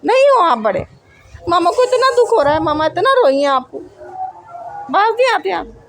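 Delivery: mother shouting at her son that he is not grown up, loud and high; real scolding shout. Real, not acted.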